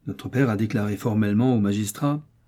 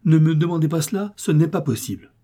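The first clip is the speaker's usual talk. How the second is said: crié plus haut et plus fort; le notaire crie son refus aux deux frères; cri; 6 semitones higher, 4 dB louder than usual. Acted. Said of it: "cria maître Béjanet". (crier le refus, plus haut et plus fort)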